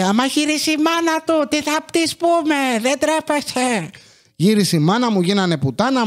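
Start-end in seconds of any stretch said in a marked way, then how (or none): 0.0-3.9 high, scolding mother, slowing to a drawn-out end; mother's high voice. Retold or imitated. His mother scolds the father, in a high, slowing voice. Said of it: "τ' άκουσε η μάνα μου και λέει".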